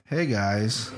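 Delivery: ordinary delivery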